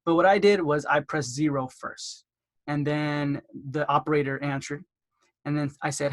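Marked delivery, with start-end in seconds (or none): none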